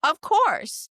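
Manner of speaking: high, loud fake delight; mock-sweet server. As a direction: beam through a forced polite welcome. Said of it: "And I was like".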